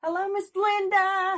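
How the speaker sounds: high, loud hello to a friend; excited greeting. Real, not acted. Greeting Miss Linda in the chat, high and loud. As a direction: call out hello brightly.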